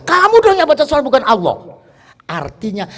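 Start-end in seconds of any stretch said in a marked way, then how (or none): 0.1-1.6 emphatic correction. Real, not acted. Preacher correcting the congregation.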